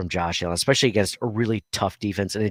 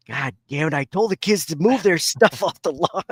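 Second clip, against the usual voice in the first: exasperated dad impression, climbing higher; grumbling dad; 7 semitones higher, 4 dB louder than usual. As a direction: complain like a fed-up father, laughter creeping in. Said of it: "He's just like".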